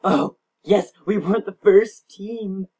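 loud, high yes, then slowed relief; excited cheer. Real, not acted. Gamer cheers when another team loses its egg first. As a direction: cheer that your team survived.

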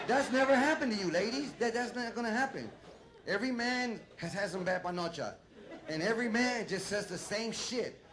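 usual speaking voice

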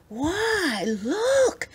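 high, astonished exclamation; excited cry. A narrator's reading. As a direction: cry out in delighted discovery. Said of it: "cried Ernest".

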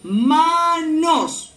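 "Manos" split ma-nos for learners; palabra por sílabas. Shown as a demonstration. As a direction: draw out both syllables of "Manos". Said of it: "¿Qué palabra?". ("Manos" separado en ma-nos)

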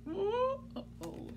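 high, hushed uh-oh of mock worry; comic uh-oh. Reader dreading what the book makes her say, mock worried. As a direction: feign dread for the kids.

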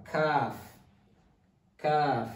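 kaf drawn out from a plain k; kaf, k sound. Shown as a demonstration. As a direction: model a long KAF with the k sound. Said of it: "the k sound".

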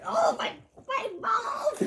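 pained shout. Real, not acted. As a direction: cry out from the sourness. Sour candy burning.

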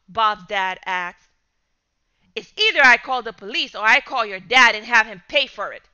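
loud, fast indignant rant; angry father. Acted. Father raging about the bumper cost, loud anger. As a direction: tear into him.

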